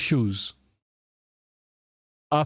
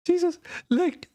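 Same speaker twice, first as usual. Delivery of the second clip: exasperated exclamation. Real, not acted. Exasperated at the speech.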